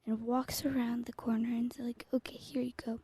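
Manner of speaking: hushed storytelling whisper. Acted. Telling the daycare story to her co-host in a whisper. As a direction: whisper it like a secret bedtime tale. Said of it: "I start whispering and tell the story".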